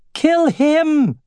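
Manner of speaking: very high-pitched, panicked command; frightened order. A narrator's reading. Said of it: "sounding more frightened than lordly".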